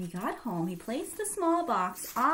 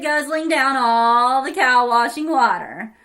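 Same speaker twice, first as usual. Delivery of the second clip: plain reading voice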